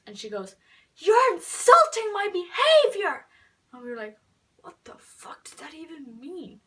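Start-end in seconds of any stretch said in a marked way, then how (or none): 1.0-3.3 high, slow, very loud mock shout; hammed-up imitation of an outraged girl calling after someone; indignant yell. Jane running after the two friends, mock indignant yell. 4.6-6.6 hushed, incredulous whisper; baffled whisper. Retold or imitated. Friends reacting to Jane's odd insult, baffled whisper.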